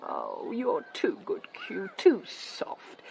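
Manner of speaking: soft, slow, low murmur; tender reproach. Acted. Wife to husband who is too soft, tender reproach. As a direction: gently chide him, drawing it out.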